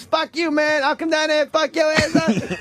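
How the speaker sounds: high-pitched mock threat; imitated angry heckler. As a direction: yell back like a riled inmate. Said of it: "they would yell shit like".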